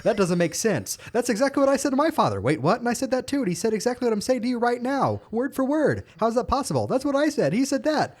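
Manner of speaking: high, fast cartoon character voice; Scooby-Doo character voice. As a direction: rattle it off like a cartoon dad. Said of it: "but it was like".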